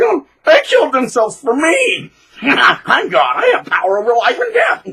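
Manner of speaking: high, loud gloating tyrant voice; mock gloating bully. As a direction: brag like a man drunk on power. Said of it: "He's like".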